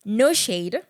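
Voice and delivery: loud, slow, stretched announcer greeting; show host welcome. Real, not acted. Host bringing listeners back from a break, big announcing. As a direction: sell the show's name, drawing it out.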